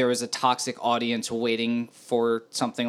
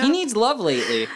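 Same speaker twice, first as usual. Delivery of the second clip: laughing tease; 7 semitones higher, 3 dB louder than usual. Real, not acted. Teasing host with laugh.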